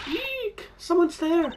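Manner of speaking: high, sudden fright squeal; startled shriek. Acted. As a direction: yelp like you've just seen a ghost. Startled by a skeleton.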